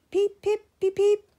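very high loud whistle peeps; shrill engine whistle toots. A narrator's reading.

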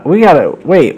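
mock fluster, pitched high; acted frantic second thought; flustered Bowser. Bowser's panic continues.

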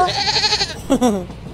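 a high, soft scornful laugh; mocking laugh. Passenger laughing off the bully's threat, a high scornful laugh. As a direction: laugh him off, amused.

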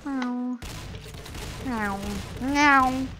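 imitation cat miaowing; playful cat meow. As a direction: meow idly like a pet cat. Imitating a cat during play.

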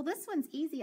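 usual speaking voice

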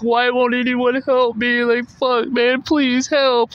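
high, drawn-out pleading wail; retold crying. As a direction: imitate a tearful, desperate plea for help. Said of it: "I just started crying".

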